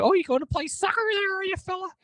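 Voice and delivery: very high, squeaky mock voice; weird mocking voice. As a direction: squeak it out like a teasing coach. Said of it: "he would say it in kind of a weird voice".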